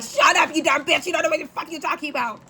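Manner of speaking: high mock New York outburst, speeding up; hammed-up New Yorker telling someone off; angry New Yorker imitation. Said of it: "Everyone from New York right now is like".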